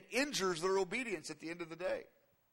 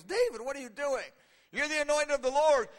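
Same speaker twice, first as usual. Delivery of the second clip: loud, raised-pitch acted rebuke; exasperated challenge; 7 semitones higher, 6 dB louder than usual. God confronting David who lost his way, exasperated. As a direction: confront him in loud disbelief.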